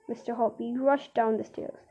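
ordinary delivery

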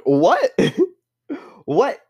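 high baffled double take with a laugh; laughing high what, what; disbelieving what. Real, not acted. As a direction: squeak it out in disbelief. Baffled by Hinge match's sign.

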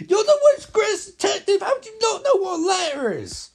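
high loud laughing rant in a put-on voice; [name] mocking Batman, high shrieking laugh-yell; mock exasperated yell. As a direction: yell it while cracking up at how dumb it is. Mocking Batman in comic recap.

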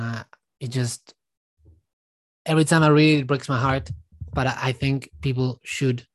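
ordinary delivery

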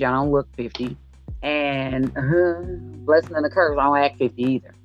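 ordinary talk

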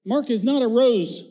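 high, loud mock-offended reply; indignant parent. Parent firing back at the teacher, mock indignant and loud. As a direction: huff it out like an insulted parent. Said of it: "The parent writes back".

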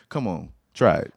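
ordinary talk, nothing marked

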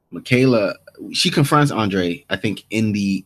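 usual speaking voice

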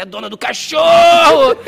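high, loud, drawn-out shout; retold loud call to a passerby. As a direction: holler it slowly across the square. Calling out to the passing woman.

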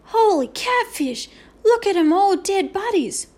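high, loud horrified squeak. A narrator's reading. Boone seeing plastic figures as dead bodies, squeaking. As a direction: squeal it in alarm, high and drawn out. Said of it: "he squeaked".

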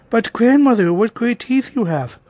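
high exclamation, loud at the start; growing girlish amazement. A narrator's reading. Girl seeing disguised grandmother's teeth, high amazed voice. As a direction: marvel aloud as a wide-eyed child.

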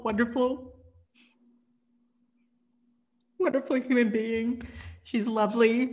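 pleading as feelings break through; getting emotional. Real, not acted. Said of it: "I'm gonna get emotional".

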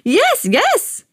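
put-on high, eager old man crying out; excited cry. A narrator's reading. Old man jumping up and down, high eager cry. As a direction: yelp it up high, impatient. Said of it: "cried the old man".

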